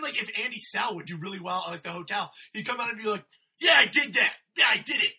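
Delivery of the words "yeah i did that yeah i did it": louder, higher boasting shout; proud shout. Retold or imitated. Comic Andy crowing after a great set, a proud shout. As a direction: crow it like you killed.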